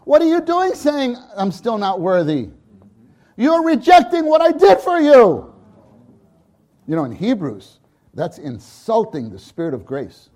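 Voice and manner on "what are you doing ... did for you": high, loud scolding; father rebuking a doubting child; emphatic rebuke. Acted. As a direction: challenge them hard. Father rebuking unworthy talk.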